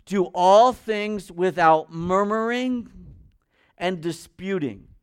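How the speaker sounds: slow, loud reading of the verse; solemn slow scripture read. Shown as a demonstration. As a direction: lean on each word of scripture, slowly. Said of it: "Look at verse fourteen".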